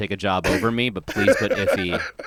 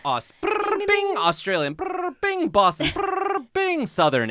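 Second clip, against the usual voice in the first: slot machine noises; slower than usual. Retold or imitated. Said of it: "doing that with accents".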